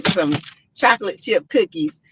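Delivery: normal talking voice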